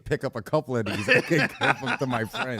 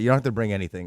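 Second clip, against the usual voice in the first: ordinary talk, nothing marked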